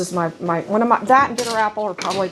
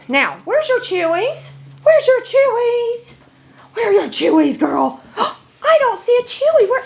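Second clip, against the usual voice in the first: playful excitement; 14 semitones higher, 4 dB louder than usual. Real, not acted. Owner playfully asking her dog where the chews are, high excited baby talk. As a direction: tease the dog with a squeaky, excited search.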